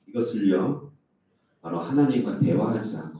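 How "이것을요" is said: normal talking voice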